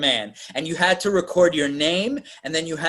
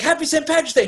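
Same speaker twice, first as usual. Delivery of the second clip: mock cheerful greeting; 12 semitones higher, faster than usual. Retold prank call greeting.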